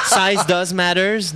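said through a laugh. Real, not acted. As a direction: laugh the words out slowly. Joking about Godzilla idol.

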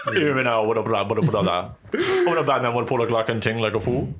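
a light mock Jamaican patois, laughing through it; playing Gary Coleman as a Jamaican, half-laughing; mock Jamaican accent. Friends request impressions, he does a mock Jamaican accent. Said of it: "Gary Coleman as a Jamaican person".

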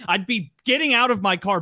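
ordinary talk

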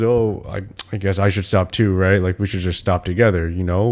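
low, laid-back mock dad; so chill. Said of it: "He's so chill about all of it".

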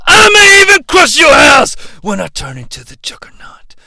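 loud, high whispered scream; promo shout. Acted. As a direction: scream the threat in a harsh voice. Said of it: "Juggernaut promo".

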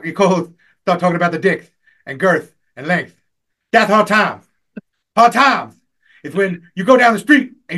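loud, high wrestling promo, rising to shouts on the big phrases; full-throttle eighties wrestler rant; Dusty Rhodes impression. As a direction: cut a fired-up wrestler promo, punching each key phrase. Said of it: "I do a mean Dusty Rhodes".

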